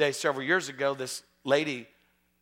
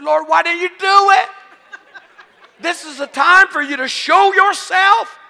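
high, loud, exasperated shout; comic pleading holler, pitched way up; acted indignant protest; 14 semitones higher, 15 dB louder, slower than usual. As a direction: cry out to the Lord in mock outrage. Acts own protest to God.

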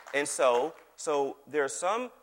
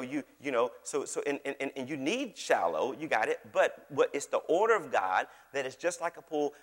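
ordinary talk

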